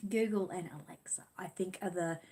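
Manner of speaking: soft unvoiced aside; whispered aside. Real, not acted. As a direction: drop to a whisper for "Google and Alexa". Aside while explaining devices.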